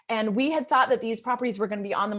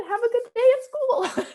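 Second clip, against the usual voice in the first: much higher, put-on cheerful send-off; forced cheer; 11 semitones higher, 4 dB louder than usual. Mother sending her son off to school, forcing cheer over the hurt. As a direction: mask the hurt with a cheery goodbye. Mom hiding tears from son.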